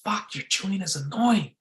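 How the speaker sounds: high-pitched mock nagging woman; annoyed girlfriend. Girlfriend annoyed at his chewing, mock high female voice. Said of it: "and then she goes".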